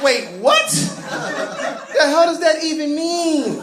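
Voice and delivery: high, slow, laughing disbelief; baffled disbelief. Acted. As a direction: drag it out, totally lost.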